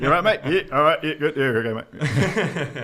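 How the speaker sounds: rattled-off Kiwi small talk, loud and laughing; quick Kiwi chat. Retold or imitated. Kiwi imitating mates hurrying through small talk, loud. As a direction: rush through it like an awkward Kiwi. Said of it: "we talk quite fast".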